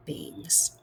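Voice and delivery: soft spooky whisper on the pun; a hushed, eerie whisper; whispered punchline. Acted. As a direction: breathe the word like a secret. Punchline of Mort L. Beings.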